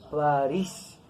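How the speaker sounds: "París" stressed on the final syllable, pa-RÍS; stress on last syllable. Shown as a demonstration. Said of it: "Con acento aquí en la última sílaba".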